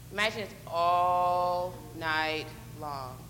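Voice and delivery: low, very slow and stretched; long-drawn wait. Pretend. Leader asking kids to imagine waiting all night, drawn-out. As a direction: draw out every word of the endless night.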